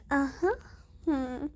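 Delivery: ordinary talk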